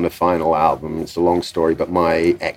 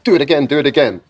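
higher, hurried relay of an order; parroting producer; 7 semitones higher, 4 dB louder, faster than usual. Retold or imitated. Producer parrots the visitor into the talkback, eager. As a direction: mimic a producer eagerly passing orders along. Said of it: "And the producer's like, on the talk back".

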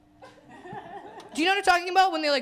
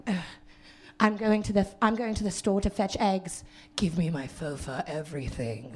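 snooty mock; 5 semitones lower, 3 dB quieter than usual. Mocking fancy Toronto people.

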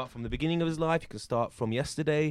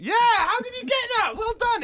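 surprised; 6 dB louder than usual. Pretend. Mock amazed at correct guess.

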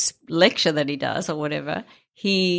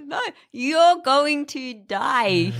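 very slow, higher, spelt-out Aussie vowels; slow Australian clarification; 7 semitones higher, much slower than usual. Retold or imitated. Doctor clarifying to confused patient, slow Australian accent. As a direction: imitate the doctor stretching each word.

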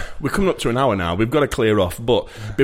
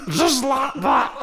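high squeaky laughing catchphrase; gremlin voice; 11 semitones higher than usual. Acted. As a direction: squeal it through giggles like a gremlin. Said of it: "Tommy Cooper sound like a gremlin".

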